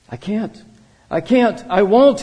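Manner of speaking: high, building to a loud cry; escalating screamed refusal. A narrator's reading. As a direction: refuse desperately, voice climbing to a yell. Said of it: "he screamed".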